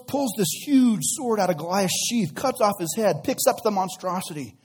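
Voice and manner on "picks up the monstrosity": usual speaking voice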